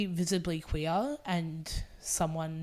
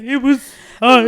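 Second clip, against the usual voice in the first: much higher and much louder, swooning; overflowing, can barely get it out; gushing emotionally; 7 semitones higher, 18 dB louder than usual. Retold or imitated. Telling castmates about her day, gushing emotionally. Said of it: "And I was like".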